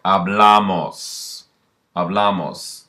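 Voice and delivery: Hablamos, slowly and clearly stretched out; slow model word. Shown as a demonstration. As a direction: spell out Hablamos slowly so the class can copy it. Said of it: "you add amos".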